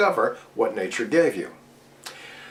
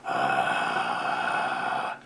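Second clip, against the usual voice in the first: a low rattly fry uh; fry demo; 13 semitones lower, 6 dB quieter than usual. Shown as a demonstration. Teacher illustrating the death rattly sound, fry. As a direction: drop into a creaky rattle. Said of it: "that death rattly".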